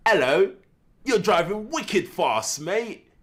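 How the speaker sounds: slow, high, showy British accent; put-on British accent. Shown as a demonstration. Officer demonstrating his British accent to a driver, drawn out and high. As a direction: show off an exaggerated British accent. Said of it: "I got a pretty good British accent".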